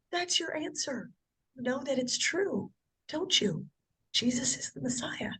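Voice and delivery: the missionary hushing Lori, ending in a whisper; hushed missionary voice. Retold or imitated. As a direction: lean in and let her whisper the truth. Sister missionary hushed, telling truth.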